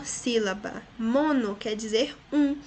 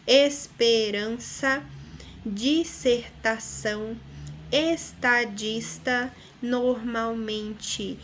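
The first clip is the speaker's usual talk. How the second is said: slow, syllable by syllable; separando as sílabas; much slower than usual. Shown as a demonstration. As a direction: pace out every syllable. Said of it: "Vejam que são palavras que possuem quatro ou mais". (exemplos ditos devagar, sílaba por sílaba)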